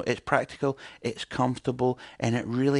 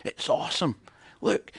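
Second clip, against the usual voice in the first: mock American; 3 semitones higher than usual. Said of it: "'Cause they're American".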